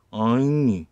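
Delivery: 엉유 with the first syllable held long and loud; 엉유를 길게 끌어서; drawn-out 엉유. Shown as a demonstration. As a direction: stretch the 엉 in 엉유. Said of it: "약간 길게 발음하면 됩니다".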